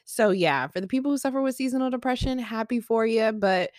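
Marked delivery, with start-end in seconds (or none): none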